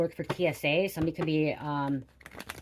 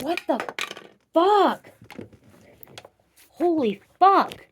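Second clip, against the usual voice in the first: high, loud, stunned cry; shocked shout; 13 semitones higher, 9 dB louder, much slower than usual. Real, not acted. Tarot reader yelling at a shocking card, high and loud. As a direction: shout in real shock at the spread.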